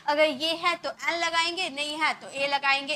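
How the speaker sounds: ordinary delivery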